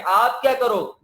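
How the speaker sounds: usual speaking voice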